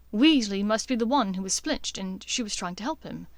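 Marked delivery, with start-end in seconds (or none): none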